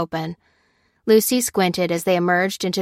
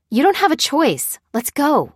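higher, emphatic push to go; insistent urging; 8 semitones higher, 3 dB louder than usual. A narrator's reading. Lucy overruling the unsure woman, insistent. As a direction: press the point, pitch jumping up. Pressing a hesitant companion to leave.

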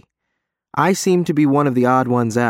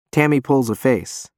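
ordinary delivery, nothing marked

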